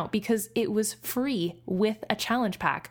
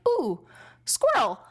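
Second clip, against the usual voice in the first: higher-pitched, playful burst of distraction; mock sudden distraction; 5 semitones higher, slower than usual. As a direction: act suddenly sidetracked by something exciting. Acting out a distracted goldfish-like person.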